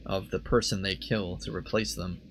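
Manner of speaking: ordinary talk, nothing marked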